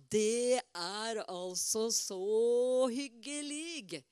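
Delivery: very slow, savouring every word; fond and drawn out. Real, not acted. Speaker fondly describing visits to her father, very slow. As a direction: linger on how lovely it is.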